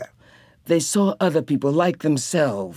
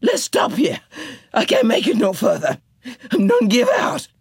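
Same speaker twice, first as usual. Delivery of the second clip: exhausted Janey; 8 semitones higher than usual. A narrator's reading. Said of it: "and Janey said".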